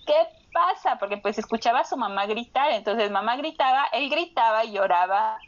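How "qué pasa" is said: higher, mildly alarmed question; bebé asustado. Retold or imitated. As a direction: imitate a startled child asking. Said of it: "estaba llorando, así como de". (imitando a un niño asustado, voz más aguda)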